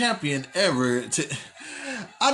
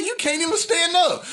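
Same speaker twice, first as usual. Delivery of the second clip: scoffing; 11 semitones higher than usual. Retold or imitated. Mocking drunk friend.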